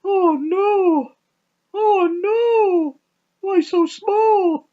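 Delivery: high squeaky ape voice, last words slow and baffled; hammed-up confused monkey; panicky chimp voice. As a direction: squeal in alarm, then drag out the question. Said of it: "and he goes".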